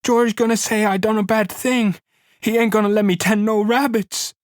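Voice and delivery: Lenny begs her to stop screaming, high and frightened; frightened begging. A narrator's reading. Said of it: "he begged".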